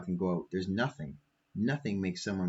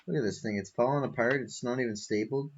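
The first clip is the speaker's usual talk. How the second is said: ordinary talk, nothing marked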